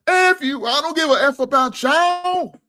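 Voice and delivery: high, loud mock of a rude woman's snarl; mocking her crude retort. Host mimics the woman cursing out the crowd, high and loud mock snarl. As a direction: sneer it out like she's daring everyone. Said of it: "You heard what she said".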